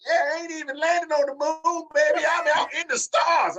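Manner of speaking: hammed-up imitation, stretching the words way up high; mimicked wrestler boast. Mocking the wrestler who used his line, hammed-up holler.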